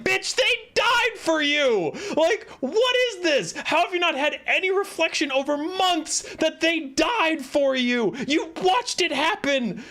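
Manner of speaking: high, incredulous tirade; outraged scolding. Acted. Streamer scolds Ciri for feeling abandoned, indignant and high. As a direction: yell it in exasperated disbelief, stretching the stressed words. Yelling back at the character.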